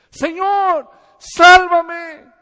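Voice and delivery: high, very loud desperate cry; desperate scream. Retold or imitated. Peter sinking in the sea, screaming to be saved. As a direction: scream for rescue as you go under. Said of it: "él gritó".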